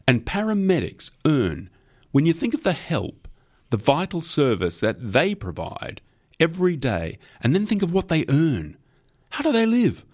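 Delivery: high-pitched indignant outburst; impassioned rant. A narrator's reading. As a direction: build the indignation, pitch climbing sharply. Torrent's rant on low pay.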